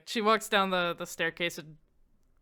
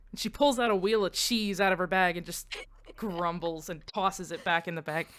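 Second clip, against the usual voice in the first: ordinary talk, nothing marked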